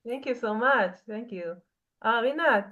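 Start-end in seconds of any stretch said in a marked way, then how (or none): none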